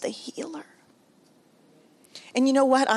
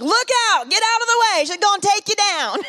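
high, loud mock alarm call; husband's hammed-up warning cry; 12 semitones higher, 6 dB louder than usual. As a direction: shout a comic warning to passersby. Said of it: "My husband would be like".

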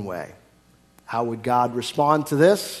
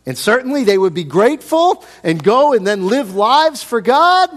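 louder and higher, building to a peak; emphatic build; 8 semitones higher, 9 dB louder than usual. Real, not acted. Preacher building up to the congregation, loud and high. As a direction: swell to a preaching climax.

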